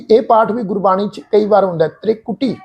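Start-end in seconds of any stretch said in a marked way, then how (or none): 2.0-2.6 ਤ੍ਰਿਕੁਟੀ as "tre-kutee", short e; soft short vowel. Shown as a demonstration. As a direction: begin ਤ੍ਰਿਕੁਟੀ with a light "tre". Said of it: "ਇਸੇ ਤਰ੍ਹਾਂ ਪਾਠ". (ਤ੍ਰਿਕੁਟੀ, "ਤ੍ਰੇ" ਪੋਲਾ ਰੱਖ ਕੇ)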